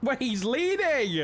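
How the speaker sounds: sudden high excited cry; slow, thrilled realisation. Real, not acted. Watching spike chase live.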